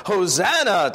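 high-pitched cry of praise, not shouted; crowd's cry of acclaim. A narrator's reading. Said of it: "For if they cry".

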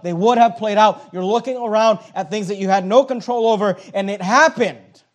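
fervent pulpit preaching, loud and rising. Acted. Preacher to congregation, reading Job with fervor.

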